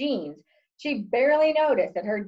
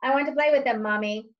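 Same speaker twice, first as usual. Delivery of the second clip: ordinary delivery, nothing marked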